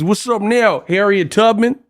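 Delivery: high, taunting gangster greeting; mock Compton gangster. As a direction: parody a flannel-clad movie tough guy. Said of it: "they flanneled up with the khakis".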